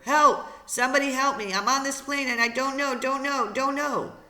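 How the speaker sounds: loud, high panicked cries for help; panicked scream. Acted. Boy alone in plane screams into microphone, panicked scream. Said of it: "he began to scream into the microphone".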